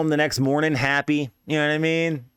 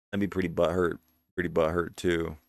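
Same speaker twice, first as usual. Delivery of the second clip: quiet sympathy; 8 semitones lower, 7 dB quieter than usual. Retold or imitated. Sympathising with the jilted friend.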